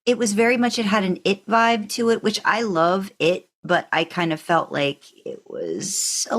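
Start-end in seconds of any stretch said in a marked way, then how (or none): none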